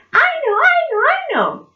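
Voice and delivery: very high, loud, excited squeal; high-pitched repeated pleading to be picked; eager puppet monkey. Acted. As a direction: burst out to answer first.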